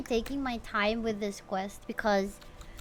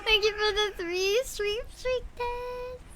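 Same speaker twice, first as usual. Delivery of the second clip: higher, slow, giggling through the thanks; laughing thanks; 11 semitones higher, slower than usual. Real, not acted. Streamer thanking chat for the streak, laughing. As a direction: laugh it out as you thank them.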